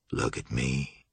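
very low, hushed order; commanding Julian. A narrator's reading. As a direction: order it softly, deep down. Julian commands the frightened woman.

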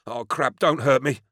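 high, frightened plea; fearful pleading. A narrator's reading. Cornered man begs the stranger not to hurt him, fearful. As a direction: beg in panic not to be harmed. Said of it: "pale with fear".

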